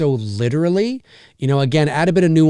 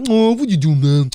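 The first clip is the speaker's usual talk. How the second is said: doofus voice; 2 semitones higher than usual. Pretend. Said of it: "That's my doofus voice".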